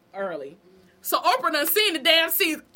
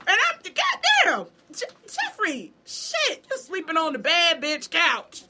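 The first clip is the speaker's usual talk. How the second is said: high, loud outburst at a character; shrieking at the TV; 5 semitones higher, 5 dB louder than usual. Real, not acted. Fan yelling at Jeffrey on screen, high and loud.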